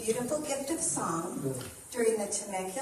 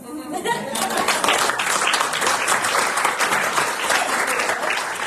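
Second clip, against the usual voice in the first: loud, long, open laugh; big burst of laughter; 5 semitones higher, 11 dB louder than usual. Real, not acted. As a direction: let out a big hearty laugh. Laughter after the announcements.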